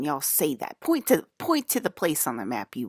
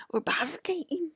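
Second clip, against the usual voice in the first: excited whisper; 13 semitones higher, 3 dB quieter than usual. Real, not acted. Excited secret news.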